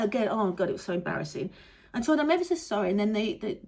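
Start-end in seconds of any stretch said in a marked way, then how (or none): none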